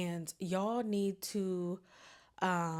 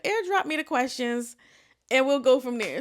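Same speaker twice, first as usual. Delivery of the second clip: eager instruction; 4 semitones higher, 7 dB louder than usual. Retold or imitated. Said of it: "I said".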